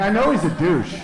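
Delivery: normal talking voice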